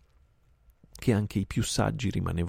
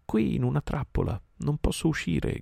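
put-on boy crying out; 10 semitones higher than usual. A narrator's reading. Trapped boy Mowgli calling out to his friends, raised and higher. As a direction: call out to friends from inside the trap. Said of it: "gridò Mowgli".

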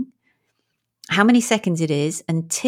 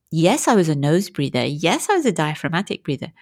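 usual speaking voice

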